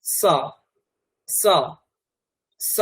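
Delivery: স as a sharp hiss with the tongue at the teeth; dental s. Shown as a demonstration. Teacher demonstrating the dental s to the class. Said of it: "দন্ত স যদি উচ্চারণ করেন কি করবেন?".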